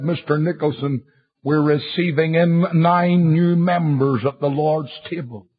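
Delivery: normal talking voice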